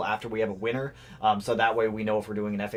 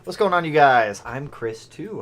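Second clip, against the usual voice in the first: normal talking voice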